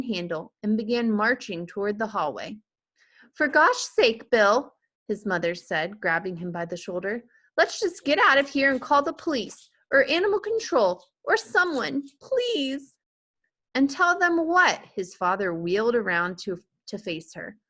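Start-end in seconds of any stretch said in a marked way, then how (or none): 3.3-12.9 high, louder panicked plea; wife begging husband to call the police; frightened pleading. A narrator's reading. 13.7-14.8 high, louder snapping question; father snapping at his wife; exasperated retort. A narrator's reading.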